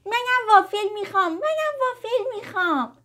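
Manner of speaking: put-on very high, eager little-girl squeal; excited. A narrator's reading. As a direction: pipe up excitedly, begging for a treat. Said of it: "سریع با هیجان گفت". (با هیجان)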